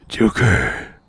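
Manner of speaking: loud gravelly Batman growl. Acted. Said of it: "a really bad Batman impression".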